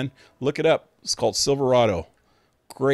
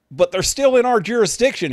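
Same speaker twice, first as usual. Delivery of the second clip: much higher and louder, urgent objection; frantic protest; 11 semitones higher, 7 dB louder than usual. Retold or imitated. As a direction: plead with the English sheriff at full pitch. Posse rider protests to sheriff.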